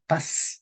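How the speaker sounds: "paz" ending in a long s; Latin American pronunciation. Shown as a demonstration. Teacher shows Latin American change, "paz" with final s. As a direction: finish "paz" on a hissed s, no th. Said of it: "En Latinoamérica luego tenemos otro cambio".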